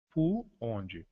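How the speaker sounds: ordinary talk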